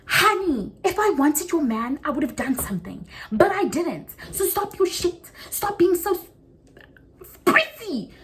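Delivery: mock diva telling the girl off; sassy comeback. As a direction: clap back at her with full sass.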